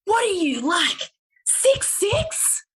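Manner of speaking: barista guessing her height, shrill high mock shout. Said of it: "He goes".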